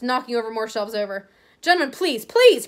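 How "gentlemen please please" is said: high, loud, rising to a desperate cry; cried out. Acted. Shop assistant pleads with brawling men, a loud high cry. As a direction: beg them to stop, panicking. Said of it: "cried the assistant".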